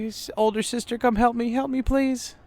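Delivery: high, panicked plea; frightened pleading. Acted. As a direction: beg for help in a panicked voice. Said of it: "He's like".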